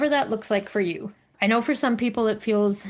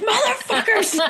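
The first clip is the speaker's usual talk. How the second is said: high-pitched mock outrage through laughter; amused, exasperated retold outburst; laughing outrage; 13 semitones higher, 3 dB louder than usual. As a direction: curse them while cracking up. Retells outrage at family's joke reply.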